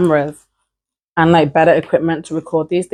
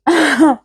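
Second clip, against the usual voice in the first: short laugh; 10 semitones higher than usual. Real, not acted. Laughs after teasing next video.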